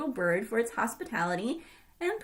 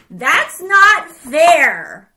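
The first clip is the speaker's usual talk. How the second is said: loud, slow, furious outburst; greedy grandma shrieking in rage; jealous rage; 3 semitones higher, 16 dB louder, much slower than usual. Acted. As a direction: explode with jealous anger. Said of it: "cried Oba-san, seething with jealousy and rage".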